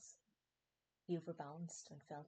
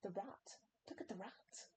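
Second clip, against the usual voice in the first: frantic plea; 4 semitones higher than usual. Acted. Desperate plea.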